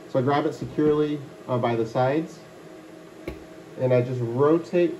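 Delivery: muffled inside head enclosure; boxy enclosed reverberant voice; speaking inside a plastic box. Real, not acted. Seen 5: Clear plastic tote with blue shroud covers head throughout speech.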